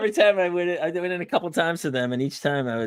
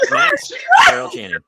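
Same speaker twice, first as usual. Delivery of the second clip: loud, slow, high call of the name; drawn-out shouted announcement; mock announcing shout; 7 dB louder than usual. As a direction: belt it out like a game show reveal. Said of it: "I would have said".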